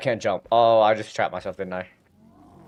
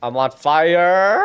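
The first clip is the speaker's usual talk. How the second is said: loud, higher, slow rising yell; alarmed shout; 6 semitones higher, 6 dB louder, slower than usual. Real, not acted. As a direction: shout it in panic as the flames hit. Character burning in game.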